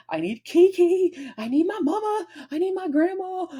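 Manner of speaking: high, desperate cry for family; mock sob for family; high pleading wail. Crying out for loved ones.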